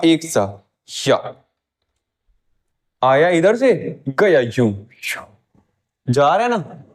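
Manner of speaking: usual speaking voice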